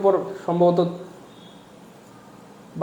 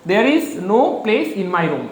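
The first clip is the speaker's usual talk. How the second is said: slow dictation; much slower than usual. Shown as a demonstration. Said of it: "place ব্যবহৃত হয় না".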